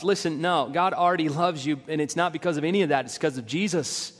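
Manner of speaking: normal talking voice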